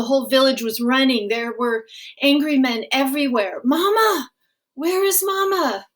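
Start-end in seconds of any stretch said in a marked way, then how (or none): 3.6-5.9 put-on high, panicked child cry for Mama; a lost boy searching, high; small boy screaming for his mother in chaos; frightened child calling.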